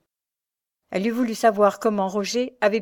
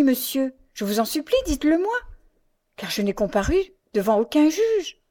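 pleading, high rising questions; indignant heroine demanding justice; distressed protest; 4 semitones higher, 4 dB louder than usual. A narrator's reading. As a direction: plead urgently, building to outrage. Henriette protests her condemnation.

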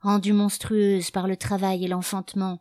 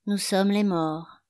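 slow echo in the narrator's natural register; drawn-out echoed line, undisguised voice; Julia echoing; slower than usual. Acted. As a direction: repeat it slowly, like an echo. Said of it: "fit Julia en écho".

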